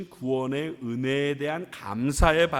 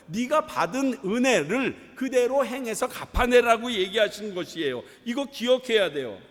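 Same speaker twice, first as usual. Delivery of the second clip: raised, forceful pulpit voice; emphatic preaching; 5 semitones higher, 4 dB louder than usual. Real, not acted. Pastor charging the church with its mission, emphatic preaching. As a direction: drive the point home to the congregation.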